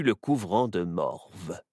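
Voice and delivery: ordinary delivery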